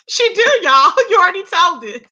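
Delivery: tickled teasing; real laughter at a friend; laughing. Real, not acted. Cracking up at friend.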